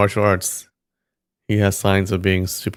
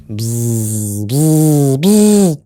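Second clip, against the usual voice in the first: bee buzzing; 3 semitones higher, 4 dB louder, much slower than usual. Shown as a demonstration. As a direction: buzz like a swarm of bees. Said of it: "I can do the bee sound effect".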